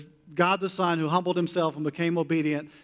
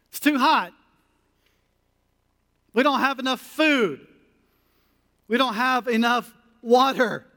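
higher and louder whiny complaints; whining Israelites; 6 semitones higher, 4 dB louder than usual. Retold or imitated. Preacher imitating Israelites griping in the desert, whiny. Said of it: "complaining constantly".

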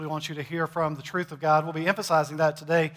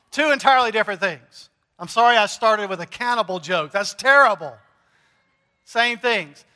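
ordinary talk, nothing marked

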